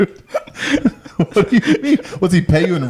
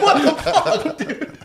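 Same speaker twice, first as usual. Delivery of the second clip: high mock customer voice; 12 semitones higher, 3 dB quieter, much slower than usual. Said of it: "It's like".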